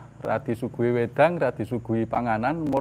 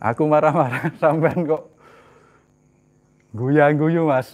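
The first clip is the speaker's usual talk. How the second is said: mock angry wife; 5 dB louder than usual. Angry wife scolding laughing husband.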